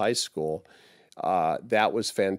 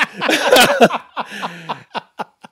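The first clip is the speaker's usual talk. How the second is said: high, loud burst of laughter; delighted laugh; 13 semitones higher, 9 dB louder than usual. Real, not acted. Telling the host the girlfriend's reaction, delighted laugh.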